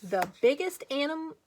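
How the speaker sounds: ordinary delivery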